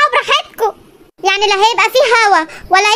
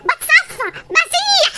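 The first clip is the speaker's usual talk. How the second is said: high, softer whimper of melting; melting whine; 7 semitones higher, 4 dB quieter, much slower than usual. Acted. Wife moans to her husband she is melting, high. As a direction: whine it weakly, higher.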